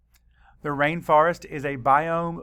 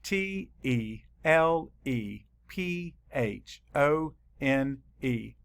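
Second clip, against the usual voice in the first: slow spelling; slower than usual. Shown as a demonstration. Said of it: "Let's spell it".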